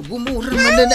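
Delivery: angry outburst. Acted. Wife gets angry, shouts.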